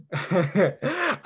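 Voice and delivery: light laugh climbing at the end; amused chuckle. Real, not acted. Teacher joking about not posting good deeds, amused chuckle.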